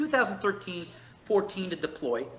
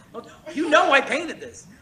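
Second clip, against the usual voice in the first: higher and louder, laughing at the doubters; laughing jab; 9 semitones higher, 9 dB louder than usual. Real, not acted. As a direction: toss it off with a laugh.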